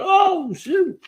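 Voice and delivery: high, sudden mock-startled squeak; startled yelp. Streamer hears a jet overhead, mock-startled high yelp. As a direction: imitate a man jumping at the noise.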